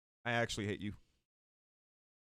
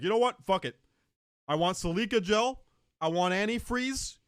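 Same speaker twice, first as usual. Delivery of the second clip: loud, high, reckless no-regrets cry; mock deathbed outburst; 7 semitones higher, 4 dB louder than usual. To chat, a mock deathbed outburst, loud and carefree. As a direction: shout it like nothing matters anymore. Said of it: "I'll just be like".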